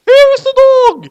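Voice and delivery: very high, loud squeaky mom voice; falsetto mom. Acted. Light's mom greeting the doorman. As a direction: squeak it comically.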